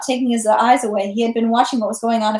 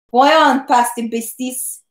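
raised cry; much slower than usual. A narrator's reading. Soldier warns Pierre off with drawn sword, mildly crying out. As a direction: call out a warning, voice lifted on the first word. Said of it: "he cried".

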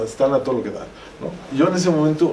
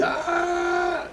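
a sudden high cry of agony; retold scream; 5 dB quieter than usual. Wounded cameraman screaming as his leg is cut, high mock cry. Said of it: "pega un grito ahí".

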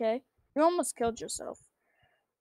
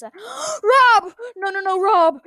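man down shout; 7 semitones higher, 8 dB louder, much slower than usual. Acted. Friend dying in game.

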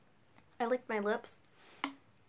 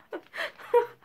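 high, loud giggle; real giggling fit; 13 semitones higher, 6 dB louder than usual. Real, not acted. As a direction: burst out in high laughter. Laughing at own look in mask.

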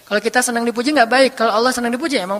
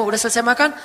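ordinary talk

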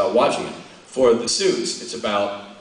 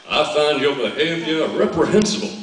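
Southern accent. Shown as a demonstration. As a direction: put on a genteel Southern accent. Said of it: "gonna do it in Southern".

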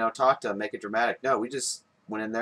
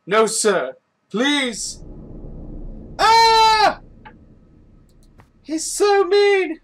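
much higher, louder pleading wail; begging servant; 7 dB louder, much slower than usual. Pretend. Gamer voicing a scared servant begging his master, high louder wail. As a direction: beg and cry out in a high voice.

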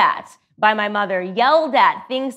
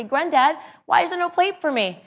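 put-on childlike plea; 8 semitones higher than usual. Child calling to granddad again.

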